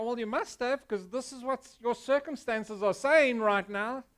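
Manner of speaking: hammed-up know-it-all friend pressing hard; accusing friend.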